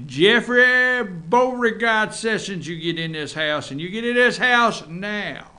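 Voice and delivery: high, loud, slow Southern mama call. Pretend. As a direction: call the boy home off the porch.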